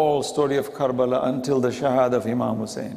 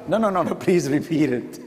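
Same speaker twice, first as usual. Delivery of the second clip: usual speaking voice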